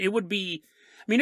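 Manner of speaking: ordinary delivery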